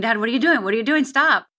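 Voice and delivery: mild retold scream, urgent questions rising to a stop. Daughter pleading with her armed father, retold mild scream. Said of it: "And I start screaming".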